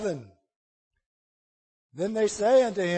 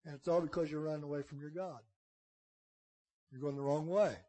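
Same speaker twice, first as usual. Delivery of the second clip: much softer, quick accusation; hushed blame; 6 semitones lower, 12 dB quieter, faster than usual. Acted. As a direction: lean in and lay the blame on him. Sailors blame Jonah, hushed.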